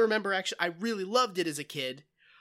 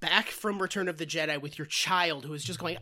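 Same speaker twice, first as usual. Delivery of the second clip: ordinary talk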